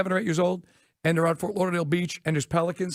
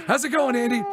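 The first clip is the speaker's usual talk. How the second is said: cheery greeting; 4 semitones higher, 3 dB louder than usual. Retold or imitated.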